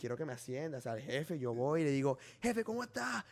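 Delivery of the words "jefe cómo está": eager, high-pitched sucking-up to the boss; fawning greeting. Retold or imitated. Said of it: "soy súper así, apoyando".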